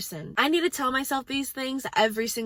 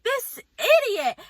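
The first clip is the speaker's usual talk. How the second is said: high, slow mock outrage at the TV; angry outburst; 11 semitones higher, 3 dB louder, slower than usual. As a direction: yell at the screen. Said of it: "I would just be like".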